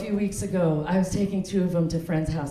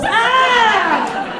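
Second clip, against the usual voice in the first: very high, loud comic scream; over-the-top frustrated dinosaur scream; anguished T-Rex cry; 7 dB louder than usual. Acted. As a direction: wail in outrage as the grumpy T-Rex. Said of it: "T-Rexes don't even have nipples".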